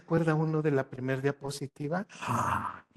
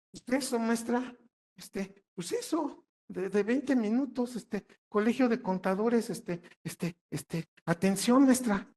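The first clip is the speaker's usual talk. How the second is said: mock fumbling student; 6 semitones higher, slower than usual. Student fumbles answer to teacher.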